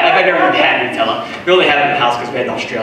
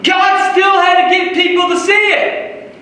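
preaching at full force; 13 semitones higher than usual. Acted. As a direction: preach it out, rising to a shout. Preaching rises in intensity.

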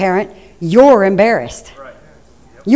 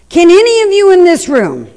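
louder, higher rhetorical question; preaching challenge; 8 semitones higher, 4 dB louder than usual. Real, not acted. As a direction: call out to the room.

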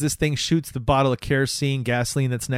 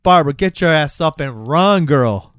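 louder, exasperated call to a character; mock urging; 3 semitones higher, 6 dB louder than usual. Host to movie character, loud mock urging. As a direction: yell it like heckling the screen. Yelling advice at film character.

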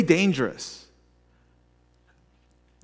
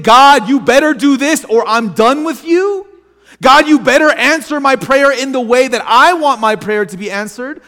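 louder, higher, ultimatum to God; louder, higher expecting-heart prayer that issues an ultimatum; demanding prayer; 6 semitones higher, 5 dB louder than usual. Shown as a demonstration. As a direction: threaten God to deliver. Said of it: "praying from an expecting heart".

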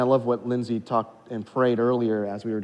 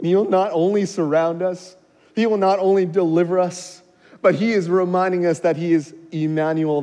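raised, louder preacher's voice; emphatic preaching; 7 semitones higher, 10 dB louder than usual. Real, not acted. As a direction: drive each promise home with force.

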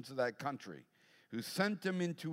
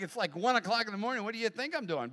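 exasperated dad; 7 semitones higher, faster than usual. Retold or imitated. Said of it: "that's not how I answered".

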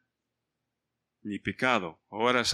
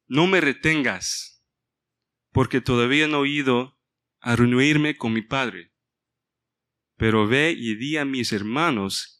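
louder, deliberate reading of Jesus' words; scripture reading; 5 dB louder than usual. Acted. Pastor reading Juan twenty to the congregation, a preaching read. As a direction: proclaim the verse to the church.

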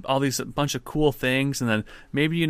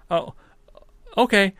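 put-on little boy; 8 semitones higher, 4 dB louder than usual. Boy replies to the lady.

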